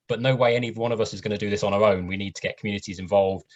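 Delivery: ordinary delivery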